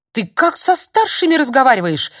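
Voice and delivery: визгливо, выше и громче; взвизгнуть от возмущения; взвизг. Acted. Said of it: "взвизгнул дядя Юра". (старик взвизгивает на Филиппа)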